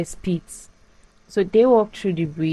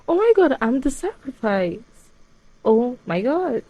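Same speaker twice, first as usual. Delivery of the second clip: much higher, gasping oh my God; horrified realization; 6 semitones higher than usual. Retold or imitated. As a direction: voice the girl suddenly realizing her fate. Heroine realizes she's the sacrifice.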